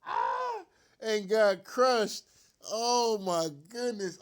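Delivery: loud, drawn-out wail of defeat; a long pained cry over the game; anguished groan. Real, not acted. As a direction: let the loss tear out of you slowly. Fan moaning about crushing loss.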